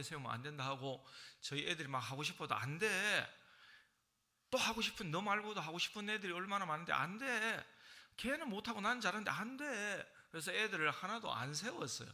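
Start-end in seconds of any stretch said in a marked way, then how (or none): 2.5-10.1 higher, louder stern no; firm refusal. Retold or imitated. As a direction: shut the kids down firmly. Father refusing his children. (아이들을 단호하게 막는 높고 큰 목소리)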